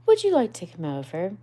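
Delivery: Rat's quick invitation. Acted. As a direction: rattle off the offer. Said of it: "inquired the rat presently".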